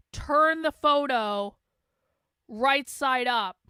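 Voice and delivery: slow, condescending; slow and pointed. Acted. Streamer mocking conservative influencers, slow and condescending. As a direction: spell it out for slow people.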